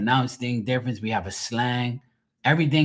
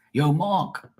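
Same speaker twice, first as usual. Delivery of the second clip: higher Bermudian call, R dropped; Bermudian accent; 5 semitones higher than usual. Retold or imitated. Locals calling out to the speaker, Bermudian accent. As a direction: hail a buddy the Bermudian way. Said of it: "They say Mark".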